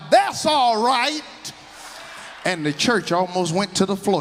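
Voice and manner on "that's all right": put-on slow, high, loud holler from an old woman; an elderly churchgoer's holler. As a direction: call it out from the pew. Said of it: "she hollered out".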